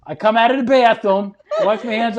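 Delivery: ordinary talk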